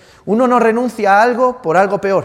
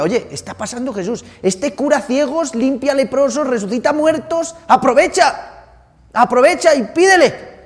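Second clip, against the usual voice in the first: higher, slow, eager urging; urgent tip-off; 7 semitones higher than usual. Acted. A bystander urging the blind beggar, eager and pressing. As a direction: nudge him hard, don't miss this chance.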